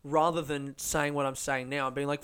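usual speaking voice

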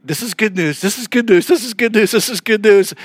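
high, insistent repeated mantra; anxious self-reassurance. Retold or imitated. Church listening to a swearing convert, high and insistent.

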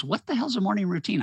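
imitating the driver's baffled outburst; incredulous.

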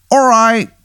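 high, hammy Baltimore "hun" voice; the worst Maryland accent you've ever heard; bad Maryland accent. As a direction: play a ghost with a terrible Maryland accent, way up high. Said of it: "He goes".

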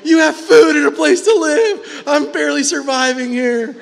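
high, loud mock outburst with a laugh; exasperated retort. A parent snapping back at a complaining child, loud and high.

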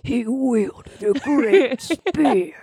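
slow, dramatic trailer read; epic fantasy narrator. Shown as a demonstration. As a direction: drag it out like a movie trailer. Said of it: "like if a movie trailer narrator read that".

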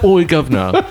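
mock British street greeting. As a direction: put on a cartoon Cockney accent. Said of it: "doing the British voice".

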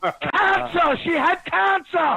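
high yell of cancer. Real, not acted. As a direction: blurt it out high, laughing. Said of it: "One yells cancer".

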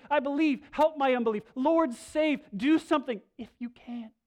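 loud, high panicked plea; desperate cry. Retold or imitated. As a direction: beg him loudly, in panic. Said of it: "They're coming".

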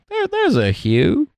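very high, slow sitcom impression; retold sitcom reply. Conceding a point in a retold scene, high mock voice. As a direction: draw it out high, imitating a sitcom character. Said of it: "He's like".

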